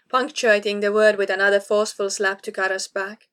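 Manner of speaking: plain reading voice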